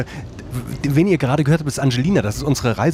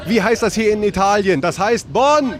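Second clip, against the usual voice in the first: higher-pitched call to a group; rallying call; 6 semitones higher, slower than usual. Real, not acted. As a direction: cue the crowd to shout back, ending on a shout. Prompts bus group to shout back.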